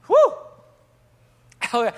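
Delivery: sharp, high "whew"; short burst of preacher's relief; high whoop of release. Real, not acted. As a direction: let out a quick high whoop after the climax. Catches breath after preaching peak.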